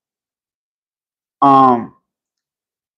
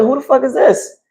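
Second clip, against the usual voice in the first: high incredulous who the fuck is this; mock disbelief at an unknown opponent; retelling his disbelief at the fight card; 10 semitones higher than usual. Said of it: "I was like".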